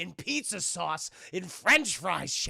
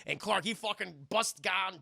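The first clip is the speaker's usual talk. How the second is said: ordinary talk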